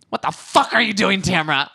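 loud, high-pitched phone outburst in imitation; mock angry yell. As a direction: lay into her like an irate caller. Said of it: "first thing Kelly says on the phone".

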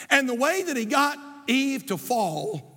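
usual speaking voice

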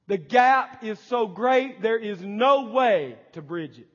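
loud, slow, ringing pulpit delivery; fiery preacher building to a peak; preaching at full force. Acted. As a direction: proclaim each phrase like a verdict on man.

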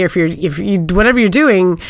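usual speaking voice

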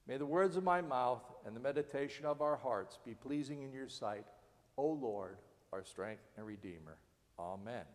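soft, hushed opening prayer. Real, not acted. Pastor leading his listeners in a soft prayer. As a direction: pray it quietly with them. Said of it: "Can you pray with me?".

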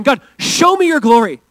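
loud, high-pitched plea; Moses. Acted. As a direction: cry out to God, urgently pleading. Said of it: "It was like Moses going".

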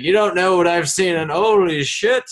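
put-on old-timer Boston accent, high and loud. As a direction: rant in a 1919 Boston accent. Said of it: "that's my nineteen nineteen Boston accent".

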